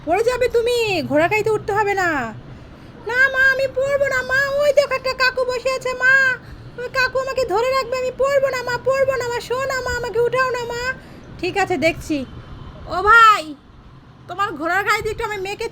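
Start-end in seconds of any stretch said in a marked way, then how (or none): 3.1-10.8 put-on higher, childlike begging voice; pleading little girl. Mother voicing her daughter begging for a horse-cart ride, in a higher childlike plea. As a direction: whine like a small girl who wants a ride.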